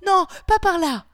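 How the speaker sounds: high, loud cry of refusal. A narrator's reading. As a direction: shout it out sharply in protest. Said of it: "je me raidis en criant".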